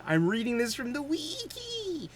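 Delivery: high, sing-song admission; high-pitched playful aside; playful confession. Real, not acted. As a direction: pipe it up high, stretching the end. Admitting he is reading aloud.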